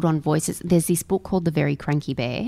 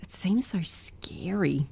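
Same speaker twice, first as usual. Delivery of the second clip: hushed spooked; 7 dB quieter, slower than usual. Real, not acted. Imagining giant bunny.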